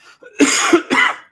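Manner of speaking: loud rasping cough; harsh cough. Real, not acted. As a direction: cough hard into the mic. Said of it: "do you have covid".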